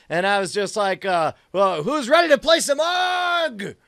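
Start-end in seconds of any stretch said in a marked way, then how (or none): 1.5-3.7 high battle cry to the team; hyped-up, raised-voice call to arms; rallying shout. Acted. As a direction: shout it like a challenge to play.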